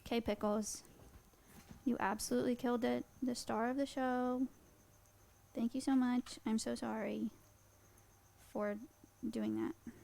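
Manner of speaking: soft, gentle praise to a pet; quiet doting pet talk; tender. Real, not acted. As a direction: thank the little bug softly. Talking softly to her pet.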